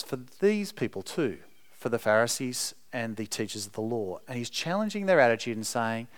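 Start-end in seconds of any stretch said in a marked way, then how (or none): none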